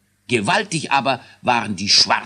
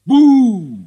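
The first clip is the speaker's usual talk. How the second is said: high, loud boo in the ear; startling shout; 12 dB louder than usual. A narrator's reading. King shouts boo into the sleeping servant's ear. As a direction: scare the sleeper awake.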